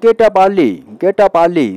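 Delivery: mock parent command. Shown as a demonstration. Teacher models a parent's order for students, firm and loud. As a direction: bark it like a parent at dawn. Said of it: "teacher models command".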